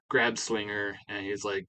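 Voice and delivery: ordinary talk, nothing marked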